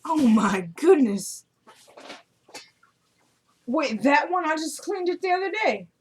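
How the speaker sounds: high-pitched complaint to someone nearby; real off-the-cuff exasperation; exasperated dismay. Real, not acted. Dismayed at mess while recording.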